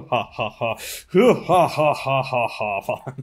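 slow, drawn-out evil laugh; villain laugh. Pretend. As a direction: cackle like a cartoon bad guy. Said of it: "Burada bir kötü adam gülüşü yapmak istiyorum".

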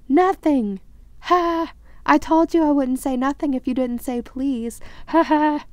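high, loud crowing taunt; gleeful poltergeist. Acted. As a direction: gloat with cackling triumph. Peeves crowing in triumph.

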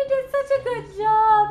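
high, loud and gushing; excited praise. Real, not acted. Wife praising her husband's babysitting, excited and loud. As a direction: burst out with the praise.

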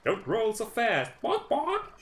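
very high hen voice with squawks; clucking hen. Acted. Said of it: "said the hen".

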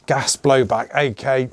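ordinary talk

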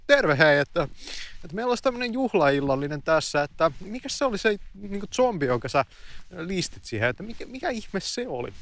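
a host greeting guests as if nothing is wrong; chirpy host voice. Retold or imitated. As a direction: play the oblivious tea-party host. Host greets, asks about zombie.

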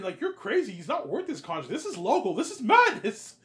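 very high, very loud incredulous yelling, laughing at the end; outraged shouting. Acted. Peter yelling at Ivan over the eleven million contract, shrill and laughing. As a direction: scream it like it's madness.

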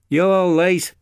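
high, loud, snappish reproach; snapped. A narrator's reading. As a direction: snap crossly at a latecomer. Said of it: "he snapped".

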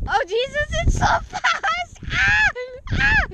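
high panicked screams; screaming on the swing. Real, not acted. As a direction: shriek as the swing whips round. Spun fast on a swing.

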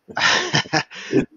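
short airy burst of laughter, up high; breathy laugh. Real, not acted. Guest laughing at his own restlessness, a short breathy laugh. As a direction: laugh it off, amused at yourself. Laughs about disliking routine.

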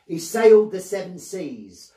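ordinary delivery

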